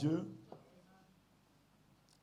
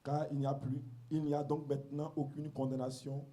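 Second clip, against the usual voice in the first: scripture reading; 3 semitones lower, slower than usual. Acted. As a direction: read the verses slowly and reverently. Reads scripture aloud.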